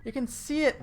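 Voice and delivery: high, slow, incredulous squeal; a pleading cry of disbelief, hammed up; mock protest. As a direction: whine it like a baffled comic insisting. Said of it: "And he's like".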